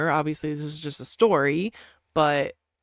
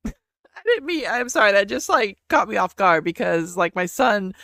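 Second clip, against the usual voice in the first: real laughter, high and louder; laughing apology; 5 semitones higher, 7 dB louder than usual. Real, not acted. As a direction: apologise through a burst of laughter, pitched up. Caught off guard, laughing apology.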